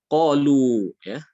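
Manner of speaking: slow drawn-out Arabic reading of Qalu; Arabic recitation. Shown as a demonstration. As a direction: recite Qalu slowly and loudly, alif left silent. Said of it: "Qalu di sini dia ditulis alif".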